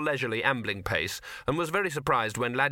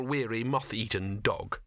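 usual speaking voice

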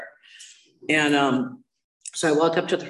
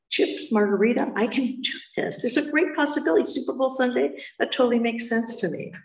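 mock-eager, higher voice; naive excitement; 8 semitones higher than usual. Newcomer misreading meeting chips as snacks, mock-excited. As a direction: play the clueless newcomer thrilled.

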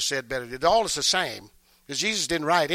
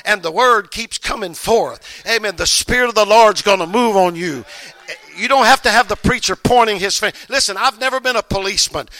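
loud, rising preacher's call to the congregation; full-force preaching; 2 semitones higher, 6 dB louder than usual. Acted. Preacher urging the congregation to seek the Spirit, loud and driving.

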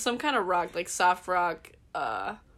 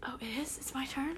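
whispering; 4 semitones higher, 11 dB quieter than usual. Real, not acted. Whispered surprise.

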